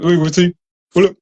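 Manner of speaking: mock a twenty-something mumbling through closed lips; mumbling young people. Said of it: "su grupo de amigos habla con la boca cerrada". (imitando a un joven que habla con la boca cerrada)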